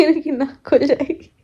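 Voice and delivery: laughing through the words. Real, not acted. Joking to viewers that kadhi will clear husband's nose, laughing. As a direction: giggle it out as a joke.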